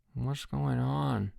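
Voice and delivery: slow, soft drowsy voice; sleepy. A narrator's reading. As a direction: mumble half asleep. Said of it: "came a sleepy voice".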